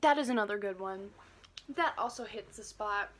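eating chocolate; chewing while talking. Real, not acted. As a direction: mouth partly full. Seen 2: bit at -4.0; closed, working mouth at 1.0 and 3.1.

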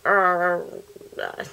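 abandoned stab at a character voice; half-hearted wordless voice imitation; attempted Heymans Breda voice. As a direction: try the character's sound, then give up. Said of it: "distinguishable voice".

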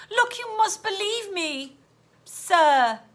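put-on high, slow, pleading girl; high-voiced, insistent plea. A narrator's reading. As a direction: plead earnestly to be believed. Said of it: "pleaded Stella".

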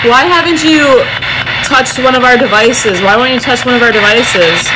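loud calls to a ghost; calling out loud. Real, not acted. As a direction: demand answers from the spirit. Loud questioning of spirit.

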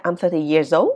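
put-on "old" jumps up like a question; wrong rising ending. Shown as a demonstration. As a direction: lift "old" sharply at the end of "I'm thirty years old". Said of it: "Hay là nói là". ("old" lên giọng cuối câu)

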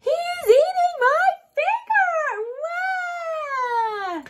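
high, loud hammed-up wail; mock cry. Penelope wails at goldfish biting finger, hammed-up. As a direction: wail it like a hurt little dinosaur. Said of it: "cried Penelope".

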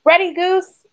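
high, lifted call; eager quack. A narrator's reading. Thistle starts the contest with Goose, eager quack. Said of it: "Thistle quacked".

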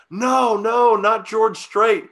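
high, loud cry of disbelief; hammed-up anguished protest; dismayed outcry. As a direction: wail it like the news is unbearable. Passengers dismayed at cab news.

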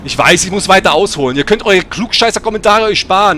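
loud, rising irritation at know-it-alls; exasperated rant. Real, not acted. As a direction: cut off the critics with mounting volume. Snaps at know-it-all commenters.

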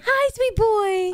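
high, slow cooing to a puppy. Real, not acted. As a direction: coo sweetly at the dog. Cooing to the dog.

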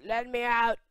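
high, loud yell to be freed; pleading shout. Pretend. Locked-in player begging to get out, shouting. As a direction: shout as if trapped behind a door.

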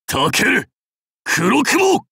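high cry of a name; battle call. Acted. Warlord calling Kurokumo in battle, high call. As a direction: call it out, pitch leaping up.

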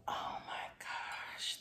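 slow breathless whisper; awed whisper. Real, not acted. Touched by a friend's gift, awed whisper. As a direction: whisper it in awe.

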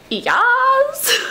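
high squealed catchphrase; Connor Franta. Acted. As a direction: squeal it like a hyped YouTuber. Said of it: "he doesn't even do that anymore".

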